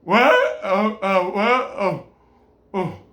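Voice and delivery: flustered sinner pretending not to know; caught off guard. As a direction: feign confusion when caught. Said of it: "You're like".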